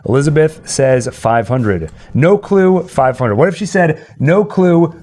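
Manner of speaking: usual speaking voice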